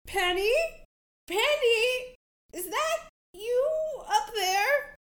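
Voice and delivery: high goofy calling from afar; goofy Herman voice. Acted. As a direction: call out like a silly friendly boat. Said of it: "the familiar goofy voice of Herman".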